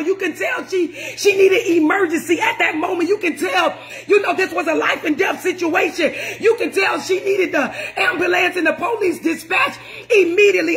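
ordinary delivery

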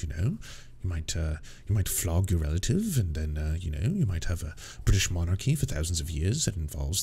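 low, soft, posh-sounding British mockery; mock British gent. Host mocking the guest's view of British culture, in a low, soft, smug British voice. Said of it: "it's like".

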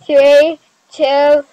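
loud, eager countdown; excited countdown. Real, not acted. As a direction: build it up, loud. Countdown to the reveal.

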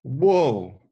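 loud drawn-out whoa; surprised whoa. Acted. Finding a crying girl: loud whoa. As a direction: gasp out a big whoa.

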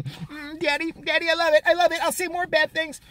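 high, cooing mock Shapiro; mock fawning. Mocking Shapiro pleasing Breitbart, high and cooing. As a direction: coo like a pet begging for praise. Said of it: "go please Breitbart".

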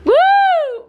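high loud woo; excited cheer. Real, not acted. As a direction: whoop with joy. Cheering again.

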